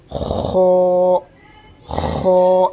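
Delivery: long خو with rounded lips and a snoring scrape at the start; full-mouthed letter. Shown as a demonstration. Teacher shows first full letter, rasping rounded خو. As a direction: rasp خو and fill the mouth with the vowel. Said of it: "پر بھی ہونا ہے".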